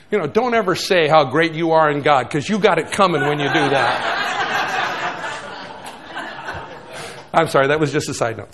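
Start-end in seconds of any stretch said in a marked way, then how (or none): 4.1-7.3 laugh. Real, not acted. Laughs at own side note.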